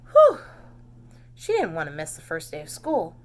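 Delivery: plain reading voice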